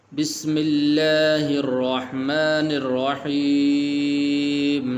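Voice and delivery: slow, drawn-out melodic tajweed recitation; slow tajweed chant; Quran recitation. Shown as a demonstration. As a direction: chant each word long and measured.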